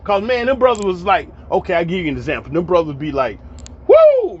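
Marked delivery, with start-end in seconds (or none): 3.9-4.4 a sudden, much higher and louder whoop of excitement; dramatic woo. Retold or imitated. Barbershop brother hollering about his week at Six Flags, excited whoop. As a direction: let out a big, excited high whoop. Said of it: "they be real dramatic".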